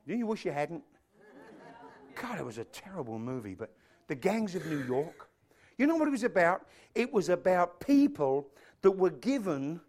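normal talking voice

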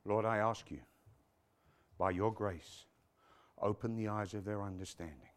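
low, soft, hushed closing prayer. Real, not acted. Preacher praying for congregation's understanding, hushed prayer.

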